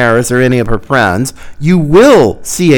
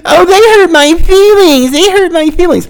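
whiny, high-pitched mock crying; hammed-up wail, much higher, mocking complainers; mock whining; 13 semitones higher than usual. Host mocking the left's complaints, whining high. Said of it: "you're gonna whine and yell and complain".

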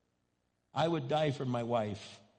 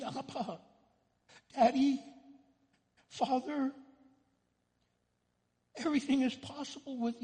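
higher pleading voice of Jesus; anguished prayer; 10 semitones higher than usual. Acted. Preacher voicing Jesus in Gethsemane, pleading. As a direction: beg the Father to take the cup.

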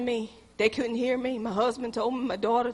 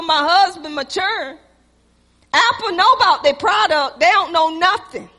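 loud, higher preaching burst; preaching at full force; 5 semitones higher, 7 dB louder than usual. Acted. Pastor mocking trust in Apple, preaching loud. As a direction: press the point on the church.

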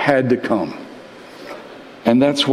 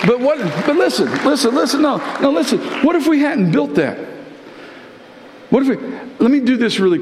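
high, quickening urgent pleas; listen, listen, rising fervour; preaching at full force; 10 semitones higher, much faster than usual. Acted. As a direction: grab them and insist.

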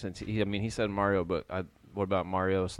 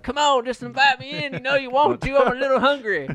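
high, loud creepy coaxing; David Arquette vampire; 10 dB louder than usual. Retold or imitated. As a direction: tempt them to invite you in. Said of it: "David Arquette in fucking Buffy the Vampire Slayer".

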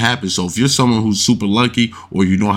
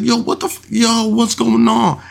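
higher, slow drawn-out confusion; imitating confused crowd; 14 semitones higher than usual. Retelling the table reacting to the dealer, high baffled mock cry. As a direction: stretch it out, baffled. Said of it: "So now everybody's like".

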